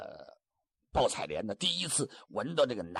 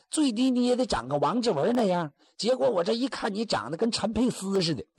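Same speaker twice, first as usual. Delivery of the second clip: a touch of a teasing raised voice; mocking girl; 7 semitones higher than usual. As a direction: needle him about his looks. 姑娘嘲笑马云龙长相. (姑娘轻声挖苦)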